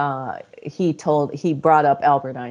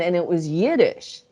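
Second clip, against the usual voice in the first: ordinary talk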